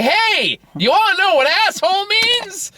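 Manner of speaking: put-on high, loud kid's eager call; child shouting to his sister at a restaurant. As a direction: blurt it out proudly, like a kid at dinner. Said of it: "loudly turn to my sister and say".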